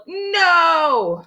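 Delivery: high, drawn-out shouted no; yelled. A narrator's reading. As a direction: yell a long refusal. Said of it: "all yelled".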